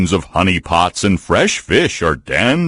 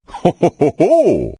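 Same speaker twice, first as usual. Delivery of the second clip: hearty ho-ho laugh; 9 semitones higher than usual. Acted. Bear gloats over points.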